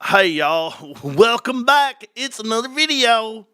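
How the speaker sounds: mock Louisiana accent, laughing through the greeting; a hammed-up Louisiana YouTuber hello, slowed on Welcome back. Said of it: "Louisiana accent".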